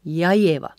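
linked ja Ieva, beat landing on IE; stress on IE. Shown as a demonstration. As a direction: hit the first syllable of Ieva. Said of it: "emphasis on the first syllable".

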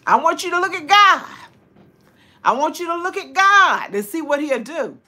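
high, emphatic testimony, lifting up GOD; exultant testifying. Real, not acted. Urging viewers to look at God, exultant testifying. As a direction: urge them with rising fervor, pitched high.

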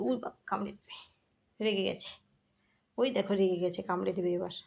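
soft, low hushed warning. Real, not acted. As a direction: keep voice down, the pet's angry. Pet getting angry, hushed. (চাপা গলায় সতর্ক করা)

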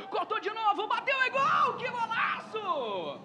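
building, stretched goal yell; explosive goal commentary. Real, not acted.

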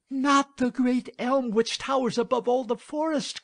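high, loud maiden's protest; dismayed cry. A narrator's reading. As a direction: cry out in dismay, pitched well up. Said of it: "cried the maiden".

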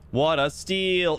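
usual speaking voice

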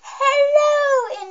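high, loud, long hello; drawn-out greeting. Pretend. Girl greeting her internet viewers, drawn-out high hello.

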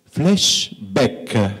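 put-on Italian-accented flashback, with flash said as flesh. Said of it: "They say".

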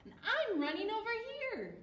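quick, high, loud character call; Billy's voice. A narrator's reading. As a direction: shout it out fast and high. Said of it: "said Billy".

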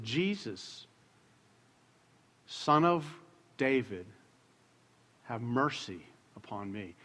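hushed prayer. Retold or imitated. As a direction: pray it low and quietly, phrase by phrase. Retells praying with eyes closed.

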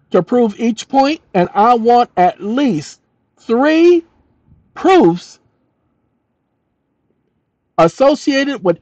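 dictating with spoken punctuation. Acted. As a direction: read it out for voice typing. Dictates an AI prompt aloud.

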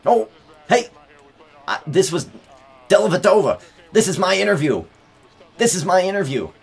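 high, loud playful protest; excited interruption. Real, not acted. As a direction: barge in on the interview. Crashing the interview.

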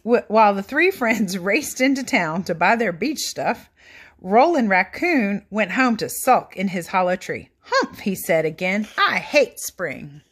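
put-on lively read-aloud for children; animated picture book narration; storybook reading. A narrator's reading. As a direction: read it like bedtime story time.

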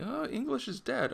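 high, soft questioning exclamation; mock surprise. Wolverine reacting to the librarian's language remark, mock surprise. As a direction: pitch it way up, lightly taken aback.

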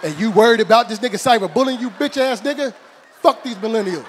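very loud, high exasperated rant; outraged yell. Acted. Uncle berating nephew over a bully in Australia, outraged shout. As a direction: blast the kid in comic fury.

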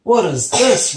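high, very loud outraged roar; big storybook roar, pitched high; roaring sun. Acted. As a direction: roar in startled protest. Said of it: "roared the sun".

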